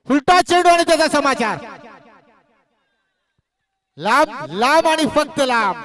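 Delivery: rousing commentator's cry; excited big-hit call. Real, not acted. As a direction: burst out high and loud, then swoop on each लांब. Big hit called live.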